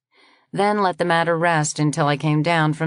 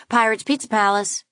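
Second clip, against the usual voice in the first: higher, bright shop greeting; cheerful phone greeter; 6 semitones higher than usual. Acted. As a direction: answer the phone like a pizza shop. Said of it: "the friendly voice".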